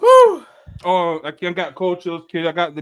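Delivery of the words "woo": very high, loud whoop of pain. Real, not acted. Reacting to the hot sauce burn.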